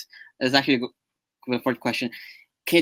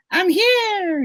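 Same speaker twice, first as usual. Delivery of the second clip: voicing a fan; 4 dB louder than usual. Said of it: "he says".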